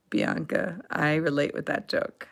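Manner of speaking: ordinary talk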